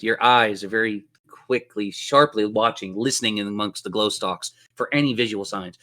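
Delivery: ordinary delivery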